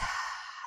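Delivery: soft breathy sigh out; release sigh. Shown as a demonstration. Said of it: "Sigh to release".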